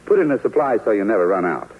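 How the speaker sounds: ordinary talk, nothing marked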